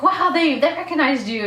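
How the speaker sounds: high, loud amazed exclaim; excited exclaim. Retold or imitated. Friend beside her at the concert, amazed and loud. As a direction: burst out in amazement. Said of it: "he was like".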